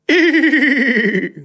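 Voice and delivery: high, loud, long mock neigh; whinny back in protest; pony whinny. A narrator's reading. Pony answering the boy with a neigh. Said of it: "Odpowiedział kucyk".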